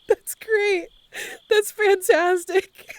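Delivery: high, loud, cracking up; laughing approval. Real, not acted. Streamer tickled by new catchphrase, laughing hard. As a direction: praise it through helpless laughter.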